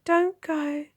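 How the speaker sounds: put-on high, loud pleading boy. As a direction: beg with all you have. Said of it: "he pleaded".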